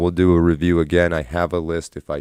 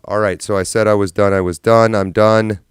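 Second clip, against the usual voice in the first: louder, fed-up declaration of being done; exasperated outburst; 3 semitones higher, 7 dB louder than usual. Real, not acted. Streamer fed up with tech trouble, loud exasperation. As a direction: throw up your hands and say you're finished. Frustrated after stream problems.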